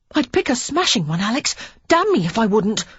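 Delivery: put-on a boy boasting with gusto; boy Henry. A narrator's reading. Young Henry promising Alex a good tutor, high and eager.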